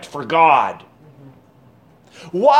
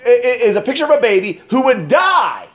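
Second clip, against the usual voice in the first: preaching at full force; 6 semitones higher than usual. Real, not acted. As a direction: hit the climax hard, voice up high. Preacher drives home dying baby.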